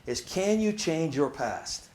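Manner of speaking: Can you change your past drawn out slowly; slow question. Shown as a demonstration. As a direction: let each word of the question sit. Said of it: "let it sit for a minute".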